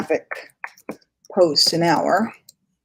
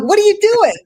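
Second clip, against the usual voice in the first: high squeaky mock confusion; startled high-pitched mock question; mock baffled squeal; 14 semitones higher, 6 dB louder than usual. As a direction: squeal it in fake alarm. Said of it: "I'm like".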